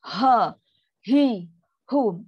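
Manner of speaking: "Ha, hi, hu" each pushed out heavily; heavy ha hi hu. Shown as a demonstration. Teacher repeats the too-heavy ha hi hu. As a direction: force out "Ha, hi, hu" with too much weight. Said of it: "terlampau berat".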